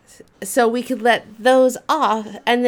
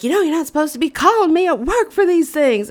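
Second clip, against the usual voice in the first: annoyed mom; 8 semitones higher, 4 dB louder, faster than usual. Retold or imitated. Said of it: "she's like".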